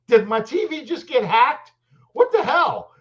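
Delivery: high, loud, incredulous outcry; mock alarm. Acting out panic at apparent hack.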